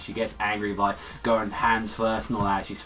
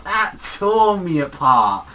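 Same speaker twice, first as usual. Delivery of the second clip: loud, high, drawn-out emphasis; overwhelmed fan outburst; 6 semitones higher, 9 dB louder, much slower than usual. Real, not acted. Fan moved by her crying, passionately overwhelmed. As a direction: stretch the words out with real feeling.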